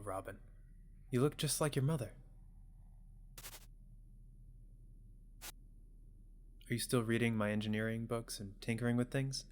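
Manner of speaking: put-on soft-spoken parent checking in on a child; gentle parent. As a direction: voice a caring parent, quietly. Voices parent talking to child.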